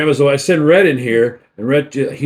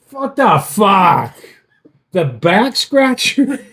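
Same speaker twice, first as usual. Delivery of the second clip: slow bewildered disbelief; 2 semitones higher, slower than usual. Retold or imitated. Dad discovering marked back scratcher.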